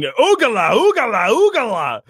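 high, loud chanted battle cry; war yell. Acted. Yelled while flinging at the enemy, high wild battle cry. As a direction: howl it like a berserker charging. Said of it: "I'm yelling".

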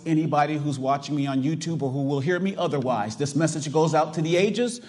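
ordinary delivery

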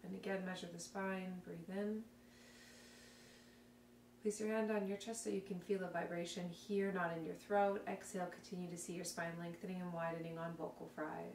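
soft, calm guided breathing cues; calm guided exercise voice. Acted. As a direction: talk them through it gently and quietly.

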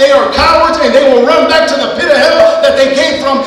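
loud, high closing climax; full-force preaching. Acted. Pastor proclaims demons flee God's move, loud preaching. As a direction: drive it home to the church.

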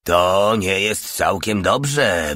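slow sleepy mumble; mumbled slowly. Acted. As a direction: drag the words out drowsily. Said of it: "wybełkotał major". (wolno wybełkotane, zaspany major)

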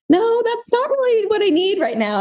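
very high-pitched, drawn-up objection; mock protest. Wife turns down husband's brush-off, high mock protest. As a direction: imitate your own plea in a squeaky-high register.

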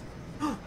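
sharp unvoiced gasp; a quick startled intake of breath. Real, not acted. Gasping at something in the game.

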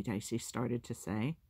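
ordinary delivery, nothing marked

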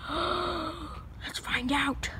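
hushed, slow breathy whisper; spooky whisper. Acted. Friend peeks for an alligator, whispering. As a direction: whisper it slowly like you're sneaking up on something.

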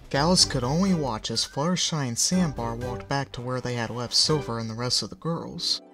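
ordinary delivery, nothing marked

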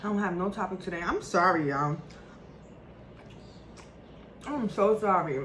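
talking while biting meat; muffled words around food; mouth full, chewing. Real, not acted. Seen 1: Face clear; she bites and chews meat from bone while talking.

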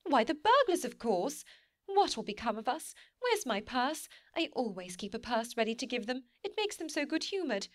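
high, flustered panic about burglars; panicked. A narrator's reading. Wife to sleepy husband about burglars, flustered and panicky.

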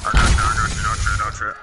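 loud, steady reassuring call; loud reassurance. Real, not acted. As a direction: shout to calm a teammate. Reassuring downed teammate loudly.

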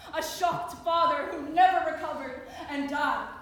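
loud, forceful grief; anguished outburst. Acted. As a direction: cry out the loss. Loss cried out in poem.

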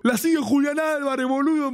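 high, overexcited imitation of a starstruck friend; awestruck mock yell. Friend insisting on a footballer follower, awestruck mock yell. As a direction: mimic a friend making it sound huge. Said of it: "me lo decía como si lo seguía Messi".

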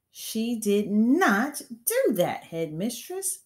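insist, slow and high; protesting. A narrator's reading. Teacher protesting the headmistress's accusation.

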